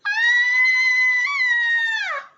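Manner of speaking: put-on very high, loud child scream; a girl screaming at gnomes; terrified scream. A narrator's reading. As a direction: shriek in fright. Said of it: "screamed Penelope".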